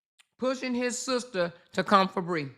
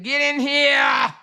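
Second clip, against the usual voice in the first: higher, slow, loud call of get in here; shouted quote; 6 semitones higher, 6 dB louder, slower than usual. Retold or imitated. Retelling a fan's message as a loud slow shout. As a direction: belt it like calling someone into the room. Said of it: "She said".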